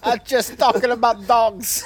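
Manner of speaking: hammed-up Chicago guy. Keeping up the Chicago impression for the friends. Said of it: "from normal guy on the street in Chicago".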